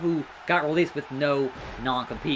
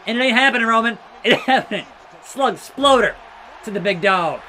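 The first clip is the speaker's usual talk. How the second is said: loud, high hype yell; hyped shout; 4 semitones higher, 6 dB louder, faster than usual. Real, not acted. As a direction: roar the finisher in triumph. Hyped while hitting finisher.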